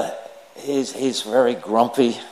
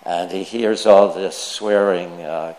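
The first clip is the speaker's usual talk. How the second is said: ordinary talk, nothing marked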